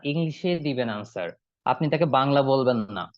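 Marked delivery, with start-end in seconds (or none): none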